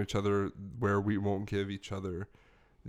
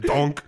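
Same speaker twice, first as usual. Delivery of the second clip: loud, higher, punchy donk; cartoon bonk sound; 5 semitones higher, 10 dB louder than usual. Host mimics a cartoon head-bonk effect. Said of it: "sound effect for when they hit people".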